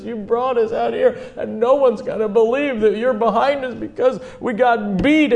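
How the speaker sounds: high-pitched whining doubter, hammed up; mock crying complaint. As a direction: imitate a tearful man complaining to God. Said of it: "he's kinda crying. Can you hear it?".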